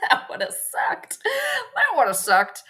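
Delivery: slow words squeezed through laughter; high, drawn-out laughing relief. Real, not acted. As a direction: laugh helplessly while you say it. Laughing over a near spill.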